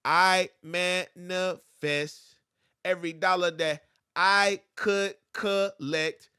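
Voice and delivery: slow, drawn-out reading of the bar; slow spoken breakdown. Shown as a demonstration. Teacher slowing a bar so students count syllables. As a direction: read it slowly to count syllables. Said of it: "this one has more syllables".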